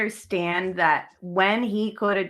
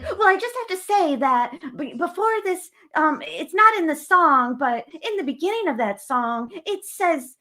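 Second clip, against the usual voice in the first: mock prissy; 9 semitones higher than usual. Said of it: "she's like".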